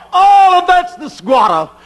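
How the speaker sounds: high, loud preaching cry. Real, not acted. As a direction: lift it up and cry it out. Preacher rising to a climax.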